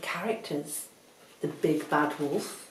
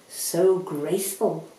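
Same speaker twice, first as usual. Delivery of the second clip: ordinary delivery, nothing marked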